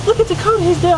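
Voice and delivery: very high female voice; imitating Tiffany. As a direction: play her high and squeaky. Voicing Tiffany in story.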